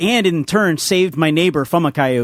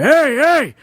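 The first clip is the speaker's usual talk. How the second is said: put-on old man yelling; 11 semitones higher than usual. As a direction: holler it across from the garage. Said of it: "just yells out".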